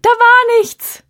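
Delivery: high, loud, slow panicked scream. Acted. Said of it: "Die andere schreit".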